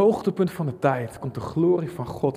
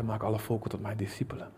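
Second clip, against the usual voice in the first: whispered commission; 4 semitones lower, 11 dB quieter, faster than usual. Acted. Jesus' commission, voiced.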